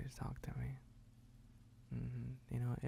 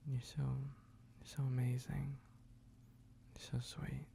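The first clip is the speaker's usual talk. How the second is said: tender whisper; slower than usual. Acted. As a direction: whisper it slowly with love. Tender compliment.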